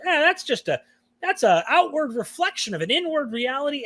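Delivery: high dismissive mock Baptist voice. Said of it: "whereas Baptists go".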